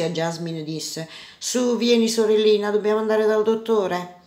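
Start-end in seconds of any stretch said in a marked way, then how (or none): 1.4-4.2 coaxing brother. A narrator's reading. Boy coaxes supposed sister away.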